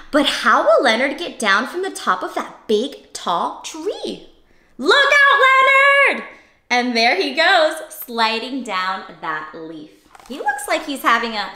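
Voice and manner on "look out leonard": high, loud warning shout; calling out a warning. Pretend. Reader warning Leonard high up the tree, loud high call. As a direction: cry out to Leonard on the branch.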